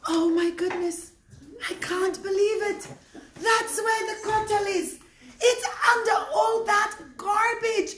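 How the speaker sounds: breathless excitement at a sudden discovery; shocked. Acted. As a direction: build to an amazed cry. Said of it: "Yehuda suddenly got shocked".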